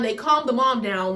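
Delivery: normal talking voice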